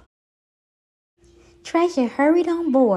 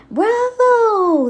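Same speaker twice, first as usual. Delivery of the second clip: high, loud cheer of delight; a bright call from the audience; cheering bravo; 12 semitones higher, 6 dB louder than usual. Acted. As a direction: shout it like the biggest fan in the crowd. Belle cheers at the show.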